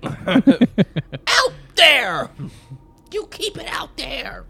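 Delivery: high, slow silly taunt. Pretend. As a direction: drag it out in a goofy voice. Mocking high voice.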